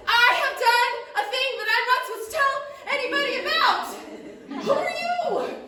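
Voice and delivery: high, loud, triumphant little gnome. Acted. Gnome alchemist cries out over his bubbling potion, high and loud. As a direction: crow it like a tiny gnome who succeeded. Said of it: "And he says".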